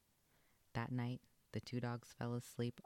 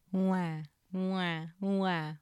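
put-on loud, higher baby crying wah; baby wail; 8 semitones higher, 9 dB louder, much slower than usual. A narrator's reading. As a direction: wail like a frightened infant. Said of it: "wailed the baby".

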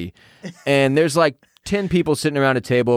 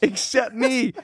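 laughing; 9 semitones higher than usual. Real, not acted. Laughing outrage at prank.

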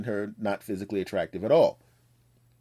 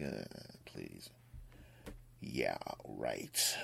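scornful onlookers; 13 semitones lower, 15 dB quieter, much slower than usual. Retold or imitated. Everyone's looking at her.

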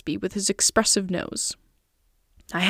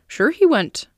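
higher-pitched, mildly indignant reply; 6 semitones higher, 4 dB louder, slower than usual. A narrator's reading. Storyteller answering a naive question, indignantly. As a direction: answer as if the question were foolish. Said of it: "flashed at me indignantly".

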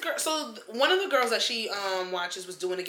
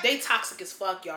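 usual speaking voice

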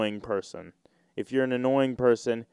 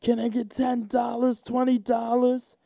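aggressive homeless guy; 10 semitones higher than usual. Retold or imitated. Comedian imitating homeless man demanding money, high and aggressive. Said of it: "homeless guy".

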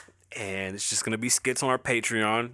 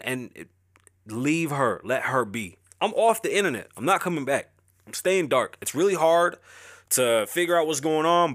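ordinary talk

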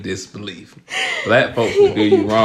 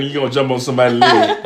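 usual speaking voice

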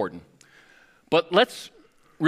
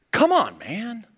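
exasperated come on; 9 semitones higher, 4 dB louder, slower than usual. Retold or imitated. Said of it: "type of question".

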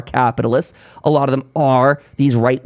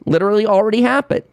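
ordinary delivery

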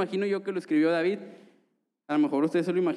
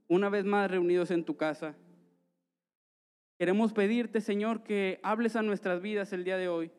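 earnest prayer, slightly higher; 4 semitones higher than usual. Acted. Leads the congregation in prayer.

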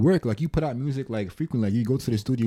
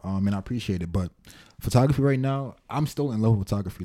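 normal talking voice

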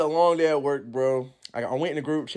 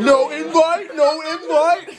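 high mock crying whine; teasing whine; 10 semitones higher, 3 dB louder, slower than usual. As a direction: whine it through laughter.

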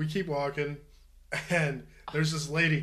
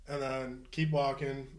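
ordinary delivery

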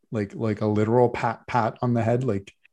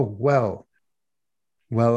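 ordinary delivery

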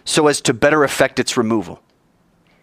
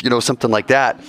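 normal talking voice

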